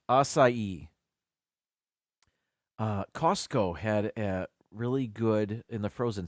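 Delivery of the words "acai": ACAI as ah-sigh-ee; three-syllable pronunciation. Shown as a demonstration. Host repeating the correction, ACAI said ah-sigh-ee. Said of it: "Three syllables".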